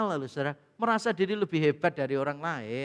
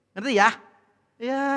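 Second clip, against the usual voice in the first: ordinary talk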